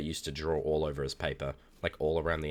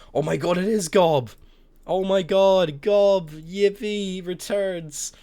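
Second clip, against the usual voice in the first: high, loud, delighted cry; thrilled shout; 8 dB louder, slower than usual. Real, not acted. Spots old drawing Gob in chat.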